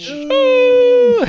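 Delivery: high piercing yell; shouting. Acted. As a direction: scream it at the top. Player joins the shoe yelling.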